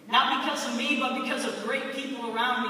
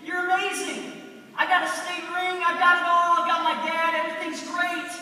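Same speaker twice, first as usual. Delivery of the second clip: higher and louder, bursting with joy; overjoyed thanks; 5 semitones higher, 4 dB louder than usual. Retold or imitated. Young player thanking God after winning the state title, overjoyed and loud. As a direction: gush thanks like you're on cloud nine. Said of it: "I was like".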